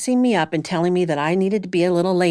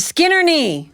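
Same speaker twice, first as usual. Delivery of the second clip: Skin her knee, higher and louder; loud demo; 7 semitones higher, 4 dB louder than usual. Shown as a demonstration. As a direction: lead the audience line with a laugh. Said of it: "one line that you need to say".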